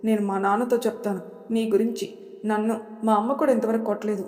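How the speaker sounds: ordinary delivery, nothing marked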